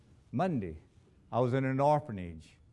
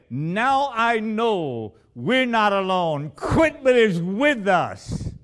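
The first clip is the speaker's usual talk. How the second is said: louder and slow, ringing out; emphatic proclamation; 6 semitones higher, 7 dB louder, slower than usual. Retold or imitated. Preacher repeating the pastor's words to his church, loud and slow. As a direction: proclaim each word slowly to the congregation.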